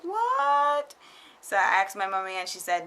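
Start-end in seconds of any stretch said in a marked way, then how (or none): 0.0-0.9 shocked parents. Retold or imitated. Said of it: "they were like".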